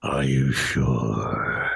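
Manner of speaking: low, very slow tempting voice; pixie. Acted. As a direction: draw it out low and sly. Inner voice tempts slowly.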